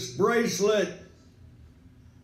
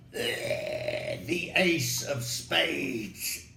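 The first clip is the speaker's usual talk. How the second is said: ominous hush; 8 semitones lower, 7 dB quieter, much slower than usual. Pretend. Dramatic last-breath scene setup.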